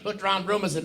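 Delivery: ordinary talk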